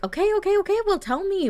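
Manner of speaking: high-pitched, impatient prodding; bubbly repeated urging; eager. Pretend. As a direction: play an excited boyfriend begging for news. Boyfriend eager for her news.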